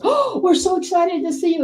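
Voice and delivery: high, loud fan greeting; gushing excitement. Retold or imitated. Friend in bunny costume greeting Dee, gushing. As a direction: imitate an over-excited gush.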